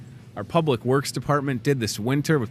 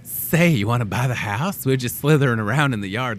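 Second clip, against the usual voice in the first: playful put-on snake voice; talking snake; 2 semitones lower, slower than usual. Snake offering to sell the house, put-on creature voice. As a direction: play a snake making a sales pitch. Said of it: "if snakes could talk".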